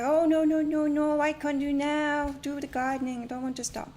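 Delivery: a lightly voiced friend refusing to stop gardening; imitating the friend. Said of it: "she's like".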